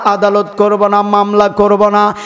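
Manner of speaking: normal talking voice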